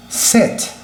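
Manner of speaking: sit said high and whispered short; English sit. Shown as a demonstration. Teacher showing sit, whispered short vowel. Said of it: "Aqui eu digo".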